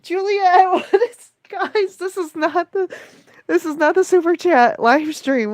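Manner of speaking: high-pitched, flustered disbelief; flustered squeal of protest, voice way up high; flustered surprise. Real, not acted. Host to guys in the live stream, flustered surprise. Overwhelmed by incoming super chats.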